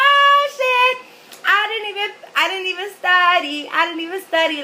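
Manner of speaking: high, loud mock-shocked crowing; gloating shock. Teasing boyfriend who failed while she passed unstudied, gloating. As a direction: rub it in with a high-pitched exclamation.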